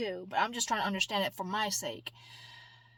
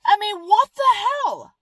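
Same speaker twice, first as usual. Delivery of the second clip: indignant outburst; 10 semitones higher, 9 dB louder than usual. Real, not acted. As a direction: voice jumps high and loud in disbelief.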